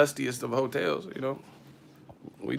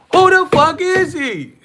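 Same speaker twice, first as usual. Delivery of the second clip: much higher, louder, slow screech; screeching mock girlfriend; 12 dB louder, slower than usual. Jealous girlfriend screaming at her man, high mock screech. Woman yelling in argument.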